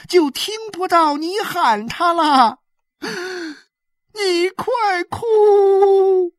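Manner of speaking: high, loud wail with stretched endings; desperate wailing plea. A narrator's reading. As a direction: plead frantically, building to a long cried-out final word. Desperate woman begging boy to cry.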